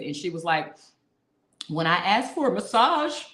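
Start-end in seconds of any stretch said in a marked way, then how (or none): 1.7-3.2 mock plaint. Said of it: "looking like she was about to cry".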